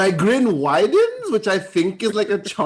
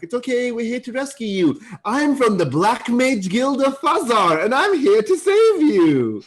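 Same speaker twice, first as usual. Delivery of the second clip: high, sing-out rescue announcement; heroic rescuer; 8 semitones higher, 5 dB louder than usual. Acted. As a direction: proclaim yourself their saviour. Hero announces rescue to captives.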